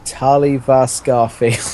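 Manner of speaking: ordinary delivery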